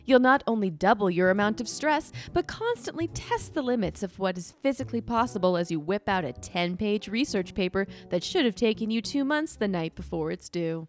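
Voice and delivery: upbeat commercial announcer; ad read. Acted. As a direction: sell it like a TV ad.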